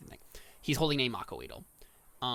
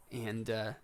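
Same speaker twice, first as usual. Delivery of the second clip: normal talking voice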